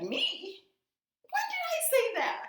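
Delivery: high, loud fake disbelief; mock innocent Portia. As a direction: squeak it out like she never said it. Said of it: "She's like".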